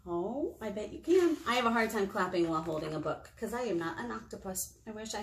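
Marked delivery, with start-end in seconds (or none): none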